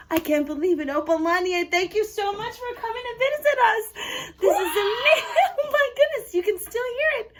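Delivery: high, loud, gushing thanks; hammed-up amazement. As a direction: call delightedly after a departing pretend bird. Thanks the departing pretend eagle.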